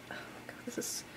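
breathless whisper over the box; hush, as if the box might break; awed whisper. Real, not acted. Whispering in awe to viewers as she opens the album.

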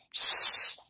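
sharp, forceful hushing hiss; loud shush. A narrator's reading. Everyone shushing the one who shouted, loud shush. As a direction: shush him loudly. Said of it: "shouted everyone".

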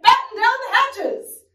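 high, loud storm command; shouting. Acted. Captain orders crew as the storm hits, shouting. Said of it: "Braidbeard shouted".

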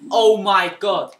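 drawn out, very loud disbelief; shocked oh my god. Real, not acted. Gamer stunned by a kill, loud slow disbelief.

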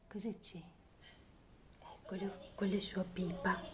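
whisper. Real, not acted. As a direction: hush it, breath only. Whispering to baby.

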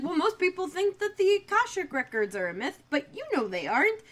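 high-pitched, pressing retold argument; mock insistent explaining. Phoebe persuading Eric that witches exist, mock insistent. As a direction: reason with a stubborn disbeliever, voice lifted.

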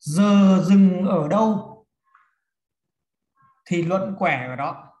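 slow, loud opening, then a pause; emphatic dictation. Shown as a demonstration. Teacher giving students a rule to write, slow and projected. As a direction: dictate the rule slowly, projecting the first half.

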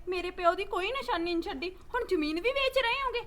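ordinary talk

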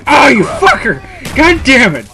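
high, furious shout that cracks; cracking voice. Real, not acted. Gamer cursing the player who got him, voice-cracking shout. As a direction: yell so hard the voice breaks. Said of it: "I just had a horrible voice crack".

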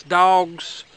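usual speaking voice

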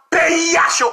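high-pitched mimicked cry; mimicked outcry. Commentator mimicking the alarmists, high outcry. Mimicking what they keep saying.

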